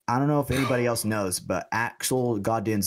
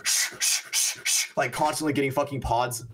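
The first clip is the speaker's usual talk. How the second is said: quick unvoiced "shh" bursts; imitated filling noise; 3 semitones higher than usual. Imitating a very fast pod filler at work, rapid shushing noises. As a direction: mimic the rush of pods filling with shushes.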